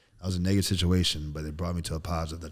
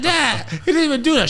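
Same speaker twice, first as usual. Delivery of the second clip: put-on high, loud child's protest; little kid pleading; 12 dB louder than usual. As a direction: plead with dad like a small boy. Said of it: "I said, I said".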